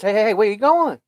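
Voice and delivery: mock hail across a restaurant; calling after him. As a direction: call out to a man heading for the door. Manager calls after leaving man.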